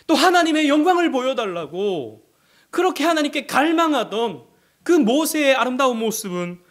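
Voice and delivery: high, loud rhetorical preaching; fervent pulpit delivery; full-force preaching. Acted. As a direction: drive the point home to the congregation.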